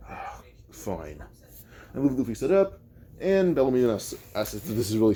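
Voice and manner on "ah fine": very soft, breath-heavy mock scoff; scoffing reply. Everyone in the bar answering Luffy, scoffing. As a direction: let out a low, grudging scoff. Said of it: "Everyone scoffs".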